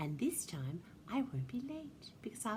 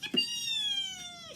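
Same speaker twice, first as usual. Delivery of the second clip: very high, squealed cheer; shrieky little reindeer's cheer; excited shout; 3 dB louder than usual. A narrator's reading. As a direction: squeal it out in joy. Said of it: "shouted Ruby".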